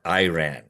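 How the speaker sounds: Iran said "eye-RAN", slowly; wrong pronunciation of Iran. Shown as a demonstration. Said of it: "not Iran".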